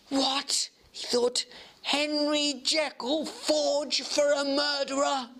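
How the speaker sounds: high, slow horrified disbelief; shocked thought. Acted. Utterson alone realising Jekyll forged a note, high and horrified.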